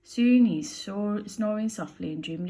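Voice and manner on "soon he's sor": ordinary delivery, nothing marked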